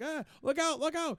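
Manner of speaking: high mock warning to people in the park; mock alarm. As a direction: yelp it like he's scared of being run over. Man in wheelchair voiced in alarm.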